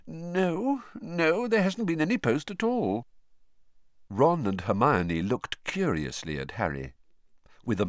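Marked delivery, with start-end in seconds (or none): 0.1-3.1 distracted. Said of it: "said Missus Weasley distractedly".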